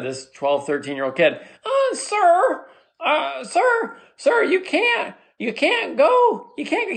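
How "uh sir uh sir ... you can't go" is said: very high-pitched young boy imitation; prepubescent teenage boy. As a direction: squeak like a boy before puberty. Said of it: "teenage kid that hasn't reached puberty in his voice".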